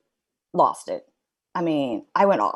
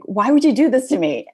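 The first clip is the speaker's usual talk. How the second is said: louder, higher plea to God; pleading complaint; 6 semitones higher, 8 dB louder than usual. Retold or imitated. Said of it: "And I heard, like".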